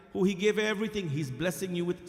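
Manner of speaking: usual speaking voice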